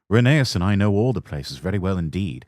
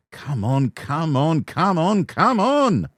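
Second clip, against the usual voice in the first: rising strain; 9 semitones higher, slower than usual. Acted. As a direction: push harder with every shout. Straining harder up the hill.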